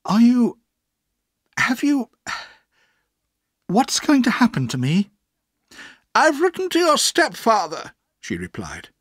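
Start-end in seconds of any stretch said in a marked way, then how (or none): 0.1-5.1 halting, high-pitched question; hesitate, then ask up high and loud; child plucking up courage, with long pauses; nervous boy. A narrator's reading. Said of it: "I bravely asked my aunt". 6.1-7.9 high, loud, decided answer; brisk aunt, pitched way up; aunt's reply. A narrator's reading. Aunt replies to the boy.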